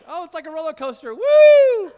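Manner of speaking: high, loud mock whoop; gleeful thrill-rider. Friend enjoying plane turbulence, loud gleeful imitation.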